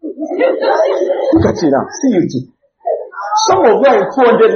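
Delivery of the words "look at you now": normal talking voice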